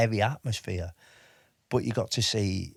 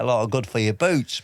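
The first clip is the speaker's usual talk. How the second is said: ordinary talk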